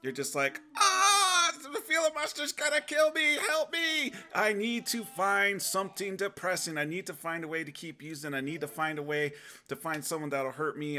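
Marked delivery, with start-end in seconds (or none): 0.8-4.1 very high, loud, hammed-up cry for help; mock panicked scream. Acts out panic at feeling monster. 4.3-11.0 raised pitch, frantic acted pleading easing off; a touch of hammed-up desperation; mock panic. Acts out panicked urge to escape.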